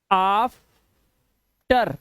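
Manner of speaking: आफ्टर very slowly, broken in two; long AA sound. Shown as a demonstration. Teacher showing how this word is read, आफ्टर in slow syllables. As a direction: stretch the opening "aah" of आफ्टर, then pause. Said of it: "यहां पे आ की आवाज".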